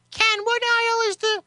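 very high put-on falsetto; pretend woman. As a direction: play a wife asking in a squeaky-high voice. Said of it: "Can you pretend to at least be a woman?".

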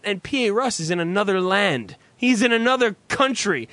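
usual speaking voice

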